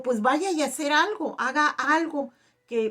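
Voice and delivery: high, loud retold prompting; retold excited urging. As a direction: urge her on, reliving the excitement. Urging healed woman to test it.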